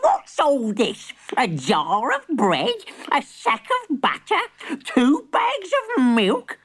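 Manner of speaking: high, slow outraged granny; indignant granny. Acted. Granny scolds list.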